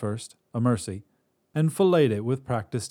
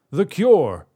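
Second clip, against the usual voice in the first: loud, high demand; demanding shout; 9 semitones higher, 10 dB louder than usual. Acted. Desperate god shouts his demand at the witch. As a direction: bark the demand at her.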